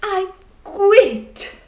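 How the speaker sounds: high, loud angry shout. Acted. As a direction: yell it at the judges in a rage. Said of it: "he shouted".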